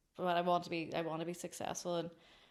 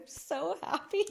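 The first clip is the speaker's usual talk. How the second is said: high, slow, tearful joy; overjoyed tears; 11 semitones higher, 3 dB louder than usual. Retold or imitated. Retelling crying in the garden, high tearful joy. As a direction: squeak out happiness through tears. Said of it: "I started crying".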